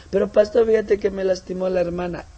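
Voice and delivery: high whimpering complaint; whiny congregant. Acted. Hurt congregant complains to the pastor, high whine. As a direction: sulk to the pastor.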